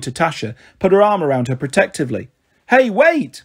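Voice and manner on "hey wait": high, urgent call; raised, high-pitched holler to stop them; shouting. A narrator's reading. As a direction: shout after someone walking off. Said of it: "Marigold shouted".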